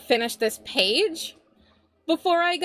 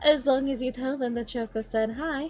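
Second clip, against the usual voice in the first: streamer break announcement; 3 semitones higher than usual. Acted. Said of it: "streamer mode".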